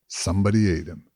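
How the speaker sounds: ordinary delivery, nothing marked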